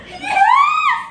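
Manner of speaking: high, loud cheer; excited shout. Acted. Comic introduces self to the audience, excited shout. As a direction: burst in with a whooping yell.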